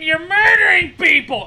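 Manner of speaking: mock the pilot's sarcasm as a shout; mock sarcastic shout. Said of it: "He's so fucking sarcastic".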